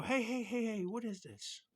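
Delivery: high, loud hey hey hey, what is this; mock boss yell. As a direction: imitate a boss barking a challenge. Bosses confront him over name tag.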